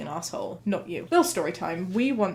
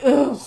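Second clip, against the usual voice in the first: a short, loud, higher "ugh" of irritation; annoyed grunt; 5 semitones higher, 10 dB louder than usual. Retold or imitated. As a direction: huff it out like a fed-up gym-goer. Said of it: "be like".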